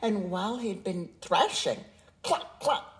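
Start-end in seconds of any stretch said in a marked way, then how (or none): 2.2-3.0 breathy high hen cluck; unvoiced high cluck cluck. A narrator's reading.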